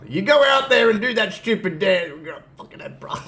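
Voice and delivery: high, loud mimicked tirade; mock berating. Co-host mimicking Chad ranting at his stablemates, mock berating. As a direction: imitate a wrestler tearing into his partner.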